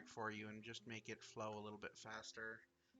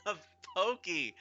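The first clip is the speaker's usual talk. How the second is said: loud exclaim; 6 semitones higher, 13 dB louder than usual. Real, not acted. Exclaims character name loudly.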